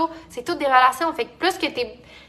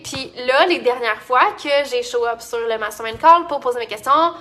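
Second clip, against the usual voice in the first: ordinary talk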